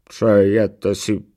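very slow, loud, drawn-out servant voice; headless knight servant. Acted. Headless knight answers his master's call, slow and loud. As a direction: answer your master in long, stretched words.

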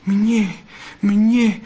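high, sobbing exclamation, not whispered; tearful disbelief. A narrator's reading. Vixen hearing she is loved, tearfully overcome. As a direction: cry it out, overcome. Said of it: "zašeptala a zaštkala".

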